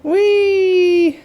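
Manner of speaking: excited whee. Real, not acted. Sliding down in game.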